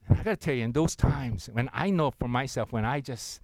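ordinary talk, nothing marked

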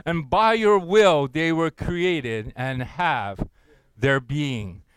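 slow, loud unison reading of the verse; congregational scripture reading. Pretend. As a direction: proclaim it together, slowly. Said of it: "I want you to read that with me".

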